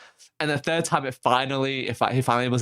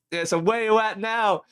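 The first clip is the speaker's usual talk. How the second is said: higher-pitched imitated broad Aussie; broad Aussie accent; 8 semitones higher than usual. Rescuer asking stranded callers their location, broad Aussie accent. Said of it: "broad Aussie accent".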